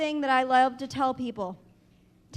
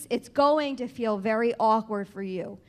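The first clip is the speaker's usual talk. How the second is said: usual speaking voice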